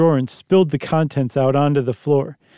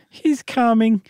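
put-on young boy voice; 8 semitones higher, slower than usual. Boy voice in reading.

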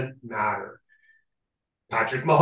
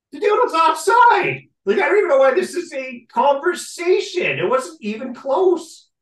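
high, loud exasperated rant; exasperated shout; 12 semitones higher, 5 dB louder than usual. Real, not acted. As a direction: shout it, fed up with the argument. Ranting about the offside call.

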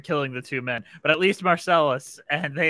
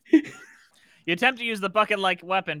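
normal talking voice

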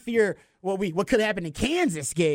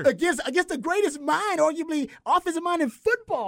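fired up; 10 semitones higher than usual. Real, not acted. Passionate defense of Texas.

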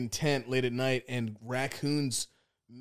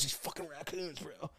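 mumbling; 18 dB quieter than usual. Retold or imitated. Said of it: "He goes".

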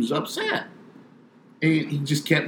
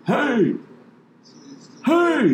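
calling out hey. Retold or imitated. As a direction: yell it across the water. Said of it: "towards me going".

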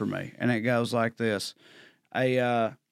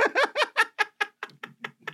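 laughing. Real, not acted. Laughs after punchline.

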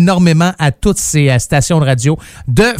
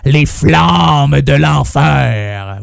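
very slow, low, drawn-out title; mock-infernal announcer; 5 semitones lower, much slower than usual. Radio host presenting a metal album title, hammed-up and ominous. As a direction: stretch each word out low, like a hammy metal announcer.

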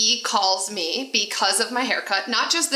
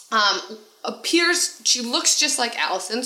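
normal talking voice

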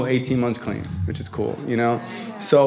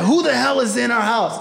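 angry dad; 6 semitones higher than usual. Retold or imitated. Dad repeats, higher.

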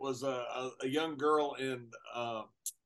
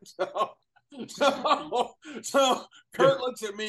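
words bubbling with laughter, higher and louder; laughing; 9 semitones higher, 8 dB louder, much slower than usual. Real, not acted. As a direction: laugh your way through the line. Laughing through the story.

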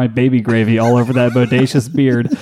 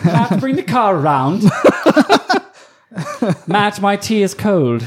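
lightly voiced haughty queen, a touch higher; hammed-up posh lady giving orders; mock queen of the band; 7 semitones higher than usual. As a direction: play the pampered queen ordering her servant about. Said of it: "No, she has a Canadian accent".

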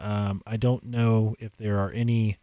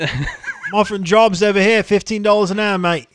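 loud, high Aussie-cockney mix; Australian accent; 9 semitones higher, 5 dB louder than usual. Acted. Podcaster plays employer offering jobs, mock Australian accent. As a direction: pitch up a hammed-up Australian accent. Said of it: "You sound like you're from London".

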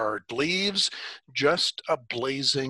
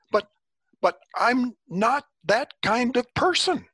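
panicked protest; 12 semitones higher, 4 dB louder than usual. A narrator's reading. Moses protests to God.

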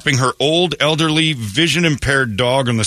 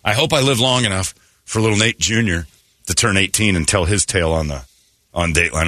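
usual speaking voice

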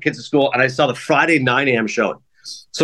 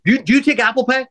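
mock panic; 9 semitones higher than usual. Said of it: "I'm panicking".

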